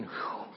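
soft, breathed-out "whew"; acted sigh of relief on waking; relieved exhale. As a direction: let out a quiet puff of relief.

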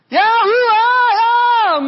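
high, loud drawn-out cry of despair; long anguished shout. Acted. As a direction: wail it out as a broken man. Preacher's anguished outcry.

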